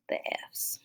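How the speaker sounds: whispered aside. Real, not acted. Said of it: "quiet aside while erasing".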